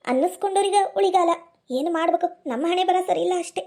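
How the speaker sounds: ordinary talk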